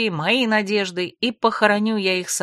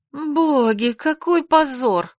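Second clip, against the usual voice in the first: higher, slower mortified cry; 7 semitones higher, slower than usual. A narrator's reading. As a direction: cry out in shame. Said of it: "Зажмурилась я".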